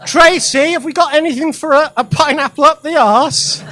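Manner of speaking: loud, high call across the ward. Retold or imitated. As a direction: shout over to Tracy. Doctor calling to nurse.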